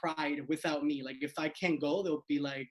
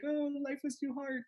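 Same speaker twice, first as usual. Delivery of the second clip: higher, softer melodramatic whine; mock teen angst; 9 semitones higher, 4 dB quieter than usual. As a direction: overdo a sulky teenager's sigh.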